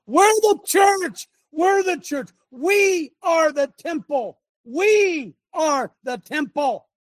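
high, drawn-out preaching cry; emphatic proclamation. Real, not acted. Preacher declares to the church who they are, emphatic proclamation.

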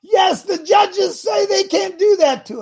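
sarcastic imitation of cheering churchgoers; mock holler. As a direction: ham up the crowd hollering yes. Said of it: "scream and holler and say".

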